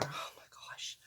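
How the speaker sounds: breathless whisper; a whispered oh my gosh at the memory of the cold. Real, not acted. Reliving the freezing walk.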